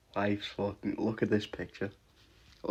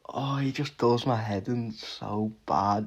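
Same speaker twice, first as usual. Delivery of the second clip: ordinary talk, nothing marked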